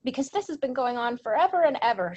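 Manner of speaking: a hint of an exasperated child; retold child caller. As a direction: imitate a girl pleading on an emergency call. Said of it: "She says".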